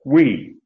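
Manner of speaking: "we" hit hard and falling; emphatic we. Shown as a demonstration. Preacher repeats the emphatic we, pulpit-pounding. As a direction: pound "we" home. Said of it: "pound the pulpit".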